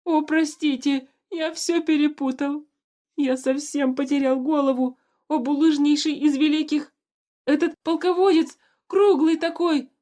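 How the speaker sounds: high loud desperate wail. A narrator's reading. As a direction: wail on your knees begging. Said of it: "завопил ещё громче".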